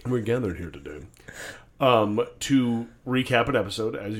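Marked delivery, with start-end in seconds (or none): 0.1-1.1 mock officiant. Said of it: "do my wedding ceremony".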